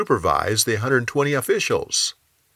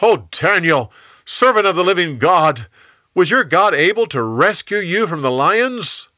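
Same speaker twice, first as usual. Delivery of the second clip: high, raised call down into the den; yelling; 8 semitones higher, 5 dB louder than usual. Acted. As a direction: call out anxiously to someone far below. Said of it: "he yelled".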